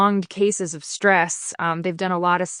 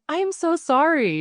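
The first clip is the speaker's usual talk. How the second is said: higher, embarrassed rushed apology; flustered apology; 10 semitones higher than usual. Retold or imitated. Retelling apologizing to the bodyworker, in a higher flustered voice.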